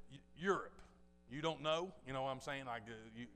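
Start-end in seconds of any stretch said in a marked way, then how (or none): 0.1-1.9 louder, irritated put-down; mildly mocking, irked reply; annoyed retort. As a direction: snap the answer at the know-it-all. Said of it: "And I was mad already".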